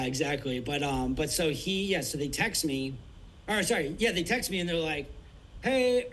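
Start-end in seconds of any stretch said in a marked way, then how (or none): 5.6-6.1 higher, louder sing-song greeting; mock cheery hey. Eccentric wife calling on the phone, mock bright hey. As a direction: chirp it like an eccentric caller.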